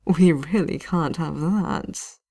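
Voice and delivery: slow, silky disapproval; gently chiding, full of threat; mock regret. Knight captain catching the mage using magic, silkily.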